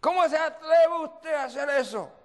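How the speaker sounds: high, loud indignant yelling, acted out; outraged scream. Woman berating the visiting pastor, acted outraged scream. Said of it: "Y me grita".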